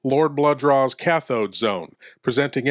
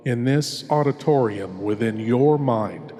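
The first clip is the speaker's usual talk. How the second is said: horror host. Acted. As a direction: draw the listener into the dark, savouring each word. Horror host introduces tale.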